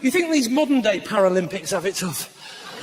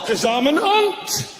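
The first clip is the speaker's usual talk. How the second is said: lightly voiced Robin; 3 semitones higher, much slower than usual. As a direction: say it proudly. Robin answers in character.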